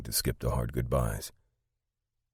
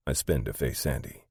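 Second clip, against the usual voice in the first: ordinary delivery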